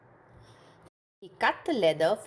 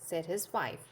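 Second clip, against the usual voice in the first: ordinary delivery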